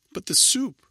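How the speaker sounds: put-on girl fears for the soup; alarmed plea. A narrator's reading. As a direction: cry out for the soup. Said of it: "Rachel said".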